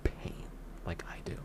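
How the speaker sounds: hushed whisper of fake wisdom; mock dramatic whisper. Imagined critic ending in a mock dramatic whisper. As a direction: breathe it out like an old sage. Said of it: "some people might be saying".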